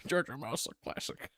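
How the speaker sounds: a whispered aside. Real, not acted. Whispering to viewers about the Jar Jar joke. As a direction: breathe it out, unvoiced.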